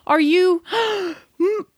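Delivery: worried friend after hearing gunshots; panicked Helga. Acted. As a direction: rush in fearing the worst. Helga finds Celine after gunshots.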